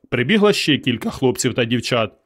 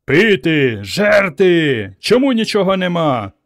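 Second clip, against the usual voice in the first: loud, high roaring demands; raging mob; 6 semitones higher, 3 dB louder, slower than usual. Acted. The apemen mob howls for drink and food. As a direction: roar for food like a frenzied ape mob. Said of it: "біснувалася юрба".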